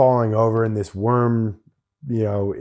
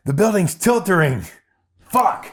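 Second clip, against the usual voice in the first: "tiltering" blurted higher and louder in a panic; flubbed nervous line; 7 semitones higher, 3 dB louder than usual. Retold or imitated. Nervous extra flubs his line to the director, blurting it out. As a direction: botch the word "teetering" as "tiltering", then curse. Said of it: "Then I lean out and I'm like".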